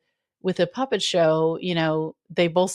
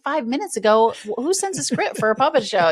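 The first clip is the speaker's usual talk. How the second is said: higher-pitched mock disbelief; retold incredulity; 4 semitones higher than usual. Acting out Olivia's banter with Ben, incredulous. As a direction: imitate someone baffled by a late request.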